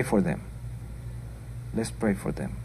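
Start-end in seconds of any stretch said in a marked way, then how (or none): 1.7-2.6 repeated much softer and lower, almost to herself. Real, not acted. Preacher urging the congregation to pray, softly. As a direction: drop the voice for the second "Let's pray for them.".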